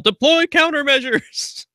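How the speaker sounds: high, drawn-out mock command; imitated battle yell. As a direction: call it out like a battle order, high and slow. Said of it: "Geoff would yell".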